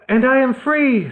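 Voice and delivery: put-on boy's relief. A narrator's reading. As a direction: claim his freedom. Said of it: "said Nick".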